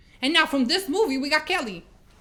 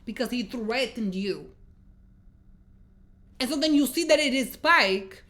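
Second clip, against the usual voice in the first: ordinary talk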